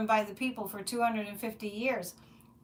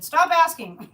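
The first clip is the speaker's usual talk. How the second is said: firm command; 6 semitones higher, 10 dB louder, slower than usual. Retold or imitated. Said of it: "That's my guide saying".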